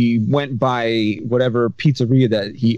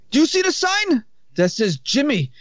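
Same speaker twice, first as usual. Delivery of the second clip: the Egyptian boss lecturing his worker; Egyptian accent; 11 semitones higher than usual. Retold or imitated. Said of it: "Pretty good Egyptian accent".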